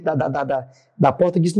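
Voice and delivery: normal talking voice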